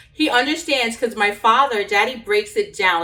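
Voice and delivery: ordinary talk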